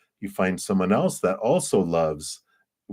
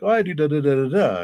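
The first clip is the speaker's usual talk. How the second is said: high, lilting da-da patter; chirpy pretend explanation; 8 semitones higher, 4 dB louder, faster than usual. Potter explains their work to a stranger, chirpy and high. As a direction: breeze lightly through a pretend explanation. Acting out an imagined pottery chat.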